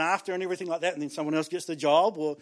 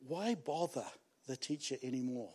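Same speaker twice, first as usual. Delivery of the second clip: defeated sigh; 6 semitones lower, 13 dB quieter than usual. Retold or imitated. Repeated story line, hushed.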